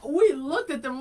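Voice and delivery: ordinary talk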